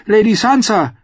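put-on girl greeting Brienne; girl's voice. A narrator's reading. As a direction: address her gently as a girl. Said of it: "sagte sie".

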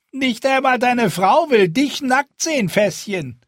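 high, loud mocking jibe; teasing spearwife. Spearwife teases the fat man, high and mocking. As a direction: needle him with a grin. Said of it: "Eine der Speerfrauen lachte".

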